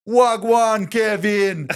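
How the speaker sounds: high, loud called greeting; loud cheery Jamaican-style greeting; cheeky call out. Retold or imitated. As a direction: shout it out the window playfully. Calling out from van window.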